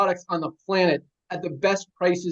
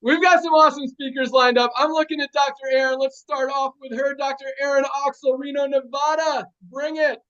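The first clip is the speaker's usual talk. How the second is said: higher-pitched hype as you hand over; announcer introduction; 6 semitones higher than usual. Real, not acted. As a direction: bring on the next speaker with energy. Host introduces the next speaker.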